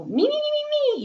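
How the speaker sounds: high, sing-song me me me; mocking self-centered brand. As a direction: parody a brand bragging about itself. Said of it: "You're not going".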